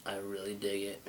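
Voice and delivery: ordinary talk, nothing marked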